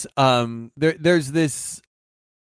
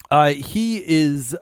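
ordinary delivery

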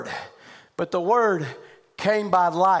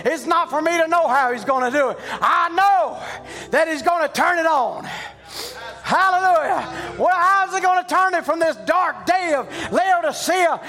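higher, louder sustained preaching; fervent preaching; 9 semitones higher, 4 dB louder than usual. Real, not acted. Pastor preaching hope of light to his church, fervent preaching. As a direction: keep the fire up as you preach.

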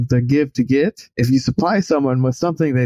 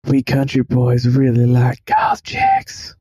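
put-on slow country-boy declaration; slower than usual. Earl leaning in to the goth girl, slow country boy. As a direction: lean in and stretch it out, building to a punch. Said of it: "Earl just kinda leans in and he goes".

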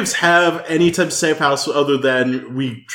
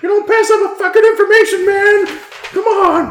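angry; 11 semitones higher than usual. Retold or imitated. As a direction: yell it, pitched way up. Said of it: "I'm like".

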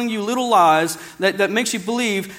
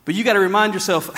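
normal talking voice

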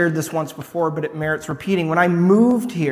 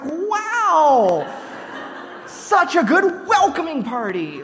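much higher, laughing mock delight; sarcastic; 10 semitones higher, slower than usual. Newcomer mocking the cashier's unwelcoming remarks, laughing sarcasm. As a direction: fake praise through a laugh.

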